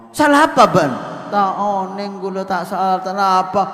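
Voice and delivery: high, loud outraged defence; a mother-in-law loudly taking her daughter's side; indignant mother. Retold or imitated.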